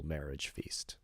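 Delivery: soft unvoiced whisper; whispered aside. A narrator's reading. Dantès confiding to the wedding guests in a whisper. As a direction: let it drop to a whisper. Unvoiced line at end of speech.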